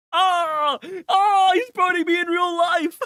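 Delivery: shrill pained cry, hammed up; high mock scream. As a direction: yelp as if on fire. Character burning in game.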